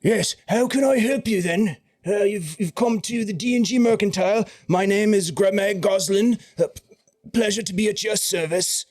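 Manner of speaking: a fussing merchant eager to help; fussy shopkeeper Gremag. Acted. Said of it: "he looks at you and says".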